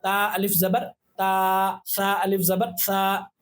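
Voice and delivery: ता अलिफ ज़बर ता, each part spaced and slow; slow spelling drill. Shown as a demonstration. Teacher spelling letters for practice, slow and clear. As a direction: spell it out slowly for learners. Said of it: "हिज्जे करके आप लोग practice करें".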